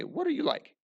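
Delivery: high, pointed question as if asking someone; curious high question; rising question. Shown as a demonstration. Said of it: "point to him and say".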